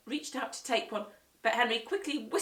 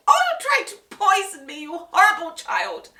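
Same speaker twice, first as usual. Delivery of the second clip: higher, much louder furious accusing; choking outrage; 11 semitones higher, 14 dB louder than usual. Acted. Guest accusing Peter of poisoning, choking angry shout. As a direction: choke out the accusation. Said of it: "she choked".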